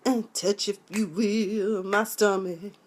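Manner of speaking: slow, soft, breathy sultry croon; hammed-up slow sultry voice; mock seductive singer. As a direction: purr it out like a torch singer. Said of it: "And I was like".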